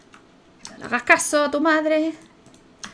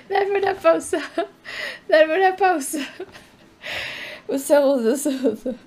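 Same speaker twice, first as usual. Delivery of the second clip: laughing; 7 semitones higher than usual. Real, not acted. Se ríe tras la escena.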